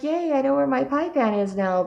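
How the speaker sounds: high, sing-out little cheer; lightly mocked excitement, pitched high; retold delight. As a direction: act out a happy "yay" moment. Acts out delight at planned baking.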